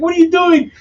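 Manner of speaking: high-pitched mock outrage; imitated outraged woman. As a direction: pitch it way up as her retort. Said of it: "and she's like".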